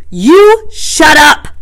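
high, loud, long-drawn shout; fierce. A narrator's reading. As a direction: silence them fiercely, stretching each word. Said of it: "Jack turned fiercely".

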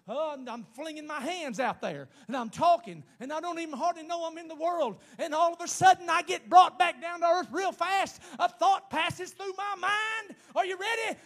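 loud, high, building to a shout; full-force preaching. Real, not acted. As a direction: drive the story up to a climax.

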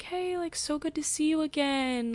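a light, breezy hello from the singer; lightly voiced friendly singer; friendly singer greeting. Singer greets her at meet and greet.

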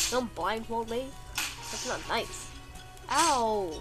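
ordinary talk

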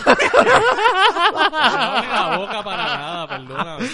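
laughing loudly, denying it; laughing protest. Real, not acted. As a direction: protest while cracking up. Denying gesture amid laughter.

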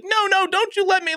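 high-pitched imitation of a panicked protest; mock frantic protest. Mimicking the mom refusing to call police, high mock panic.

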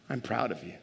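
soft, low tender assurance; lightly hushed, as if confiding; whispering. Acted. Preacher voices God's pride in listeners, soft mock whisper. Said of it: "three things I believe God is whispering".

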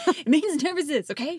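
echoing the yell, loud and high; imitating yell. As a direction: copy the yell back playfully. S1 echoes the yelled line.

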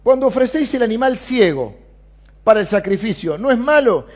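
sarcastic God challenging the priests; ironic. Retold or imitated. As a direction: needle them with ironic scorn. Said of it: "Y Dios con ironía".

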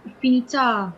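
Afinità said the Italian way, stress on the final à; teasing Italian echo of afinidad; playful fake interpreter in Italian; mock Italian. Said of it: "dejá de joder".

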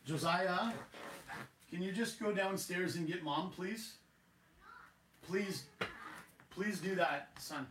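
calling off-mic. Real, not acted. Calls son away from mic.

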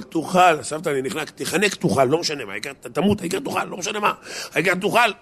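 high, insistent grandma urging him to eat; a pushy grandma who won't take no; pushy grandmother. Retold or imitated. Grandmother forcing food on choking grandson, high insistent.

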